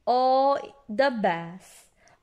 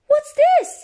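very high, loud question; high-pitched eager discovery; curious little Peppa; 11 dB louder than usual. A narrator's reading. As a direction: ask with a child's excited surprise. Peppa spots something under bush.

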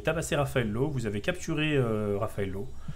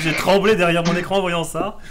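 laughing; 6 semitones higher, 8 dB louder than usual. Real, not acted. Reacting to a bad dice roll.

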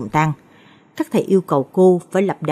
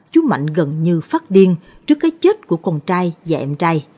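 ordinary delivery

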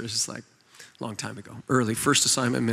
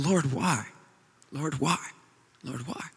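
retold grieving prayer; 4 dB quieter than usual. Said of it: "my wife was grieved".

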